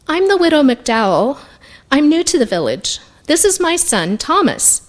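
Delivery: slightly lifted, polite introduction; lightly voiced widow. A narrator's reading. Widow introduces herself in story.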